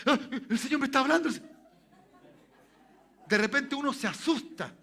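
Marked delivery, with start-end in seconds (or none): 0.1-1.3 asombro fingido y rápido de creyente; creyente asombrado. Retold or imitated. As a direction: imita a un creyente sorprendido, rápido y más alto. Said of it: "Cuando yo escucho la". (burla ligera de un creyente que oye al Señor)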